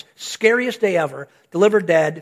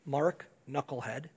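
self-scolding; 7 semitones lower, 10 dB quieter, slower than usual. Retold or imitated. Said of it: "it should say".

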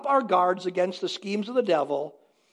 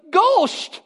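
put-on high, loud child's cry of fear; imitating scared child; 10 semitones higher, 7 dB louder than usual. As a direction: squeal it like a frightened little boy. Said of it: "he starts crying, and he says".

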